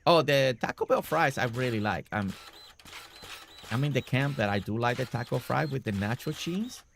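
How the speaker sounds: ordinary talk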